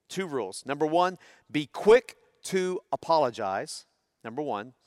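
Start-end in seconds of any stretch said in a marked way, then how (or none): 1.5-3.9 be quick to apologize said slowly, each word weighted; slow emphatic rule. Shown as a demonstration. Pastor stating the family's first rule to the congregation, slow and deliberate. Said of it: "two rules that we have infused".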